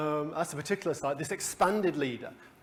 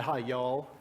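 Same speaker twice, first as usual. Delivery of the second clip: put-on Texan accent with stretched-out vowels; Texan drawl; 2 semitones lower than usual. Texan colleagues saying hello, mock Texan drawl. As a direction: greet like a Dallas oilman, drawling the Texan vowels. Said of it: "anything with one syllable is stretched to two or three".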